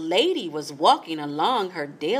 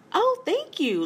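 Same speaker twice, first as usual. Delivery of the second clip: flower voice. A narrator's reading.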